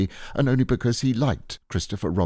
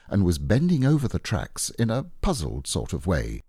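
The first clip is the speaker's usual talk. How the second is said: ordinary delivery, nothing marked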